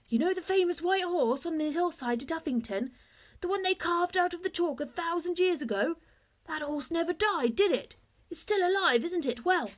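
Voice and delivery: eager excitement. A narrator's reading. Bertie explains his idea.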